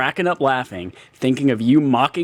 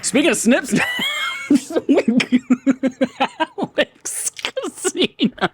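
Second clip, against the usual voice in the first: laughing shout; 13 semitones higher than usual. Real, not acted.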